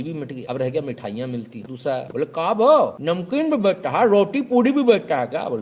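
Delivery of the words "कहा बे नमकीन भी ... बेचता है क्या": higher and louder mock interrogation; loud pushy heckler; comic shout. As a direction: grill him loudly. Know-it-all grills shopkeeper.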